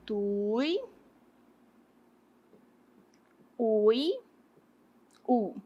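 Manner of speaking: soft, isolated "Tui, ui, u" with long pauses; "Tui, ui, u" doled out as if while writing; syllable separation. Shown as a demonstration. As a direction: say "Tui, ui, u" quietly, one piece at a time. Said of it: "Vamos separar aqui".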